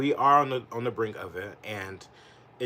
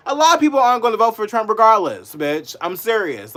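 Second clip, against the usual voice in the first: higher, louder angry retort; real angry comeback; 10 semitones higher, 11 dB louder than usual. Real, not acted. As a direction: snap back, fed up. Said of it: "so angry".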